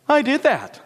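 very high, preening claim; vain boasting. A narrator's reading. Marigold sees the grass bowed to her, boastful.

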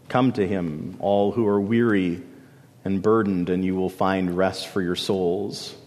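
gentle invitation. Acted. Quoting Jesus' invitation.

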